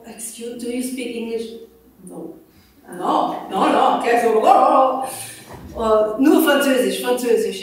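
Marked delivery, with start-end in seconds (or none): 2.1-7.6 louder, startled refusals; flustered no. Retold or imitated. The French wife answers a stranger, startled and flustered. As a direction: act out the wife's flustered no. Imitating the French wife's reply.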